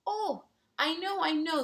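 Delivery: high, loud eager reply; excited knowing. Acted. Seahorse cheerfully answering silent tilapia, excited.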